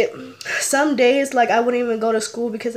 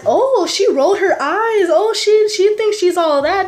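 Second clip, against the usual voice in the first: high, louder imitated bully jeer; mocking taunt; 8 semitones higher, 4 dB louder than usual. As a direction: mimic a girl making a fuss over nothing. Bully mocking her eye-roll aloud.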